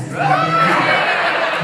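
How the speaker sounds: squeaky laugh through the words; tossed-off giggling reassurance. Real, not acted. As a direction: laugh it off high and breathy. Joking about eating ice cream.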